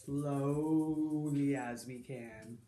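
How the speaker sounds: low, very drawn-out words; slowly. Shown as a demonstration. Leading children toward a slow hello, slow and low. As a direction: sink down and stretch it slowly. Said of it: "quickly and slowly".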